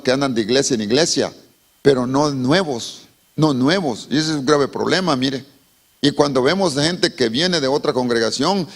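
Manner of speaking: ordinary talk, nothing marked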